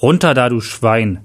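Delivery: plain reading voice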